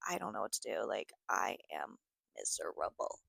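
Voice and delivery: low, quiet, defeated voice; soft, dejected imitation of giving up; retold misery. Retold miserable phone call to boyfriend.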